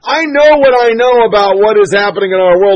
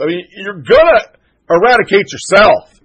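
normal talking voice

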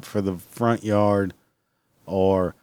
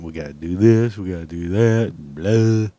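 mocking drone; 3 semitones lower than usual. Mimicking endless family obligations.

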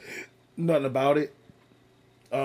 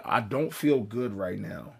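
normal talking voice

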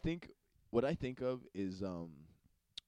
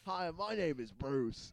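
mock Australian; 3 semitones higher, slower than usual. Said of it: "The barbie".

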